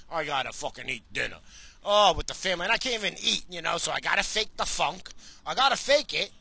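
ordinary talk, nothing marked

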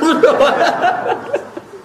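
laughs out high at his own joke; a short real laugh, pitched high; high burst of laughter. Real, not acted. Joke about Ruhin. (tertawa lepas bernada tinggi)

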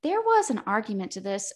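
ordinary talk, nothing marked

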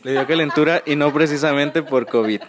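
chuckling through the words; laughing. Real, not acted. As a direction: laugh while you say it. Laughing at own joke.

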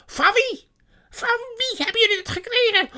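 very high, loud screeched question; enraged sorceress. A narrator's reading. Witch demands who gave the food, shrieking.

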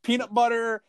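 high, hyped TV host announcing ingredients; mock Guy Fieri host voice; Guy Fieri impression. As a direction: sell the weird ingredients like a game show host. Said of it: "be like".

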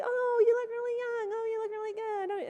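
mock gushing fan; gushing admirer. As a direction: coo like an admiring friend. Admirer voiced.

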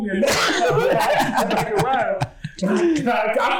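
high, laughing telling. Real, not acted. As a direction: laugh through the words.

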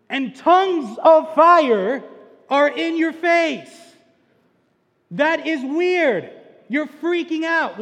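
preaching at full force. Acted. Pastor to youth group, making them feel the scene, in full preaching force.

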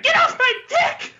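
high, loud, snapping yell; high angry shout. Retold or imitated. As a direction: scream it at the director.

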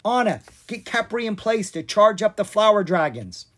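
battle command to a friend; urgent command. Acted. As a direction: bark the plan to Anna.

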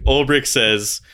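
ordinary delivery

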